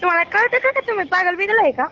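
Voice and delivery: ordinary talk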